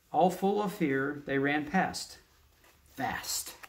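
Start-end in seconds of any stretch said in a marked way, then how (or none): 2.9-3.6 whispered. Real, not acted. Reads word under breath.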